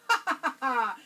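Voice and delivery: big booming laugh; a lion's amused belly laugh; hearty lion laugh. A narrator's reading. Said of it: "The lion began to laugh".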